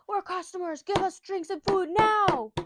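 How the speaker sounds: high, loud barked order, slowing down; demanding bandit. Retold or imitated. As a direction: bellow a mock order at the bar. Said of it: "he barges through the door".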